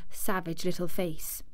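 usual speaking voice

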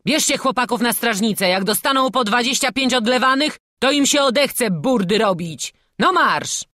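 loud, high military command; barked order. A narrator's reading. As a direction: order the arrest as angry captain. Captain orders the boys seized. (głośny, ostry rozkaz)

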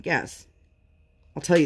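ordinary talk

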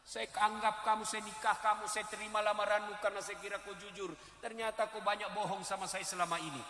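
soft, hurt complaint of a wife; disappointed wife. Retold or imitated. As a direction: quietly reproach the husband. (istri kecewa berbicara pelan)

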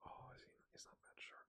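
quick unvoiced whisper. Real, not acted. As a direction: mutter it to yourself, fast. Whispered complaint.

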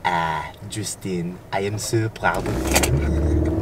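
slow deep narrator voice; slow, low and grand, like a trailer narrator. Shown as a demonstration. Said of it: "in the narrator voice".

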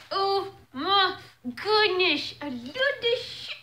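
high, drawn-out gushing over shoes; excited. Real, not acted. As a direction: squeal with delight. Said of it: "I'm excited".

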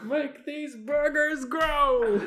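high, drawn-out villain cry; villain casting a spell. Acted. Friend mimicking villain growing burgers, high hammed-up command. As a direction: belt it out like a cartoon sorceress.

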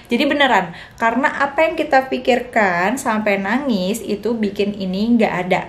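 ordinary talk

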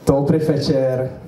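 put-on slow Czech greeting in a hard German accent. German lecturer opens his talk, slow German-accented greeting. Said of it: "Já se vžiju do role".

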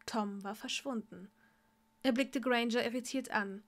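ordinary delivery

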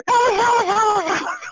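very high, loud fan shriek; imitated screaming like a girl; girlish scream. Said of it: "like a, like a girl".

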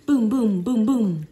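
ordinary delivery

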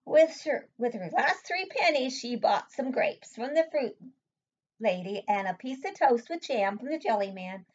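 lively, lilting picture-book reading; story read aloud. A narrator's reading. Reading Hazel's story aloud to a child, lively storytelling. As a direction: read it to a small child with bouncy emphasis.

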